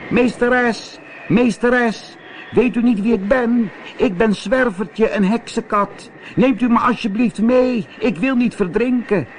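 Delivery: scared cat calling up to the witch; little cat calling out. A narrator's reading. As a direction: beg the witch from the mast top. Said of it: "hoorden hem roepen".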